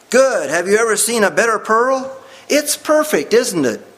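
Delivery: high, rushing, thrilled questions; amazed delight. Acted. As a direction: gush over the perfect pearl. Missionary marvels at the pearl.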